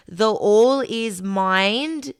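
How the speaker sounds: slow, weighty read of "The all is mind"; solemn quote. Shown as a demonstration. Quoting the Kybalion, slow.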